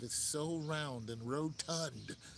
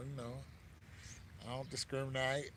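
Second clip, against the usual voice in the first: ordinary talk, nothing marked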